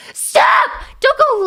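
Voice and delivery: sudden loud yell; exasperated yell. Real, not acted. Streamer stopping chat from renaming her, exasperated yell. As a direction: burst out to cut them off. Streamer snaps at chat's bit.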